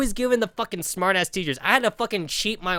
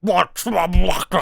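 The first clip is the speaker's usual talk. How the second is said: put-on slow, hoarse old teacher voice; imitating Doctor Humphrey; 5 dB louder, much slower than usual. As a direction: croak it like a sick old professor. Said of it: "he's all like".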